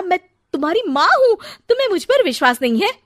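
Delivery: high, loud, fast plea; mother pleading with son for trust; hurt plea. Acted. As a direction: plead in hurt disbelief, rushing.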